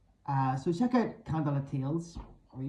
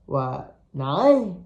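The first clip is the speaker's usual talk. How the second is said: disbelief; 6 dB louder than usual. A narrator's reading. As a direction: sound like you can't believe it. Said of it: "more out of disbelief".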